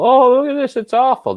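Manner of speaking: high, loud mock whine of a fussy fan; mocking complainer. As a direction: parody the squeamish fan. Mocking people who dislike rough hockey.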